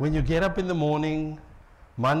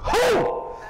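much higher, sharp call; wife's wake-up call. Retold or imitated. Wife calling her husband awake, much higher and sharp. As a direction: startle him awake. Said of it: "someone's wife is gonna go".

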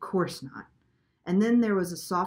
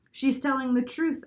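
higher-pitched surprise; surprised; 7 semitones higher, 4 dB louder than usual. A narrator's reading. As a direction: realise it with some surprise.

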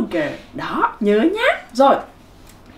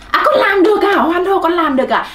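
high-pitched, cheering teacher; delighted praise; 6 semitones higher, 3 dB louder than usual. Pretend. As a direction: cheer the little learners on. Praising imagined pupils who managed it.

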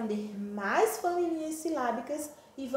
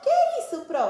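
much higher, louder pupil voice; puzzled pupil; 13 semitones higher, 7 dB louder, faster than usual. Acted. Pupil asking teacher what the word means, high and puzzled. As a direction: pipe up high with a child's question.

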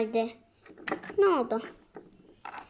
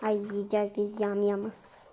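ordinary delivery

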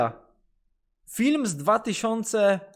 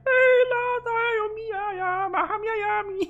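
high, slow, sing-song goofy voice; silly dubbed voice; slower than usual. Pretend. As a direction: dub a dumb cartoon character. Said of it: "A ja mu podłożyłem głos".

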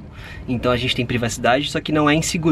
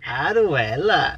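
put-on Italian accent Portuguese; much slower than usual. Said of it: "mas fala em sotaque italiano".

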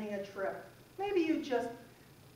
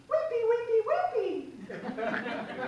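mock wimpy; 10 semitones higher, 5 dB louder, much slower than usual. Mocking a weak muscle.

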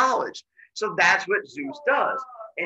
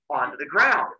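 usual speaking voice

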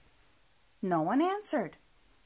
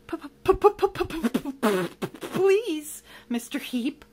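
long, high stammer on the first word; frightened stammer; 6 semitones higher, 6 dB louder, much slower than usual. Acted. Monster pleading with villain, stammering. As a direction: sputter the plea in fear. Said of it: "Lamont stammered".

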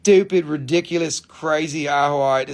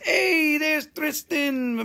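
very high, drawn-out hail; mock excited greeting; 13 semitones higher, much slower than usual. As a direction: re-enact a sing-out welcome, high and slow. Re-enacts greeting a regular viewer.

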